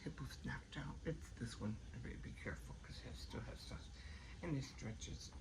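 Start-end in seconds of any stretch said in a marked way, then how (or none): 0.1-3.9 whispering. Real, not acted. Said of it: "talking low, child sleeping".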